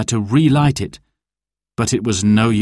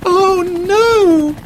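very high, louder wail of dismay; dismayed cry; 5 dB louder than usual. A narrator's reading. Sarah watches the helicopter leave, crying out in dismay. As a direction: cry out high as hope slips away. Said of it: "cried Sarah".

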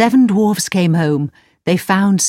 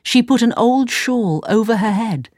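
ordinary delivery

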